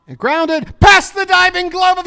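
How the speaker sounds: very high, loud shouted call; thrilled play-by-play scream; excited shout. Real, not acted. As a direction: yell the big hit as it happens.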